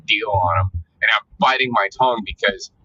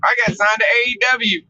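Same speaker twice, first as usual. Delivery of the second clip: much higher, hyped bragging voice; mock wrestler announcement; 10 semitones higher than usual. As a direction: parody an excited social-media post. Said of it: "I'm waiting for all of the".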